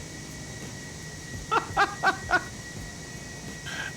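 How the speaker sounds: high, airy wheeze of laughter; wheezing laughter. Real, not acted. Still laughing at the Airbus tail.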